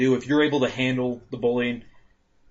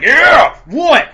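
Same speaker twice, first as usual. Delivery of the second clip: high, loud jeering yell; taunting shout; mock yell; 12 semitones higher, 11 dB louder than usual. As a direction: yell it in their face. Mocking reaction after stink palm.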